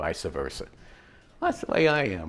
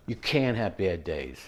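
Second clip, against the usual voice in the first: ordinary talk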